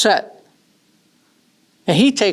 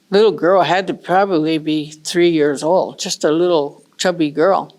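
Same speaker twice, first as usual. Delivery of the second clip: ordinary delivery, nothing marked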